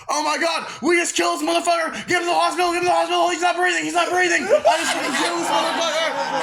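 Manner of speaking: high-pitched, frantic mock panic; retold panic. Aaron after knocking James out, high panicked yelling imitated. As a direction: act out a panicked cry for help, breathless repeats.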